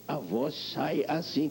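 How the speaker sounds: slow, bent character voice from a hunched posture; showing how a hunched pose bends the voice; twisted voice. Shown as a demonstration. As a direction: let the voice come out crooked, slowly.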